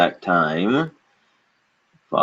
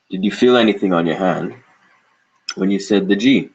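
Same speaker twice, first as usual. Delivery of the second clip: usual speaking voice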